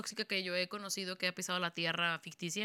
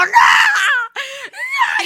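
much higher, much louder sobbing scream; retelling a sobbing no at the TV; crying shout; 17 dB louder than usual. Retold or imitated. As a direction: scream it through tears, half laughing. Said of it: "Estaba llorando y llorando. Estaba grite y grite".

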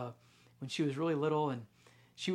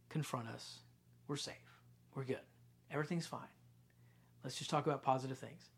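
quiet; 2 semitones lower, 6 dB quieter than usual. Acted. Quiet reading of God's words.

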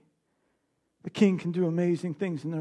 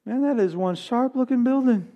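high excited "woo" from a mock admirer; mock wowed passerby admiring a building; impressed passerby; 5 semitones higher, slower than usual. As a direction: gush over the building like a dazzled onlooker. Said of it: "everybody can drive by and say".

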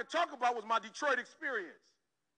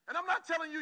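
ordinary talk, nothing marked

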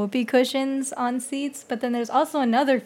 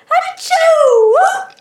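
very loud, high mock sneeze; loud dad sneeze; 12 dB louder than usual. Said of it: "Dad goes".

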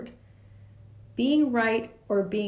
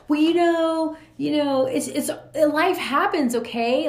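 louder, higher, dismissive shrug of a voice; with an attitude; 5 semitones higher, 5 dB louder than usual. Retold or imitated. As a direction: brush it off, impatient and condescending. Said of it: "like, with an attitude".

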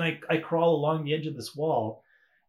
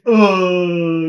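long, loud acted groan; winded groan; 4 semitones higher, 13 dB louder than usual. Storyteller waking up out of breath after a fall, winded groan. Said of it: "I'm winded and I'm, like, out of breath".

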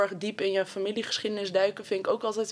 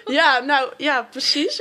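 laughing; 5 semitones higher, 8 dB louder, slower than usual. Real, not acted. Laughing reply about late date.

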